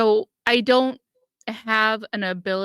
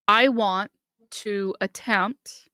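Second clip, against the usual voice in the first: ordinary talk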